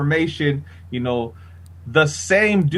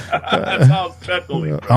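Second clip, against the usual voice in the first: laughing. Real, not acted. Laughs at the host.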